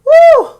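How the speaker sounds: high, loud "woo"; sudden high holler; exasperated whoop. Real, not acted. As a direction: let out a piercing whoop of exasperation. Exasperated outburst before a plea.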